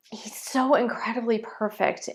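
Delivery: soft, breathless whisper through tears; tearful whisper. Real, not acted. Praising God to her viewers, tearful whisper. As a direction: barely get it out, overcome. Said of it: "you're seeing real emotion".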